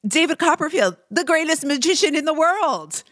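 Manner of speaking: put-on high, bragging German accent. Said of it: "German accent".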